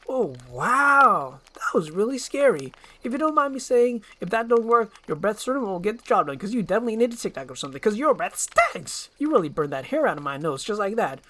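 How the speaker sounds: a motor-mouth sidekick gushing praise; fast-talking eager donkey. Acted. Donkey teases Shrek's breath.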